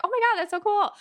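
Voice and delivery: high, thrilled gush over a cool date; gushing excitement. Pretend. Playing the thrilled date of a gamer, high excited gush. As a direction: squeal like it's the coolest thing ever.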